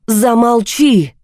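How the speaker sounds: high, drawn-out angry cry; shouting. A narrator's reading. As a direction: cut them off with a shout. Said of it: "теперь и я кричала".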